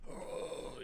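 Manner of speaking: breathless unvoiced oh; dying groan. Bloody man in crashed car, faint breathless oh. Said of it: "he's all bloody going like".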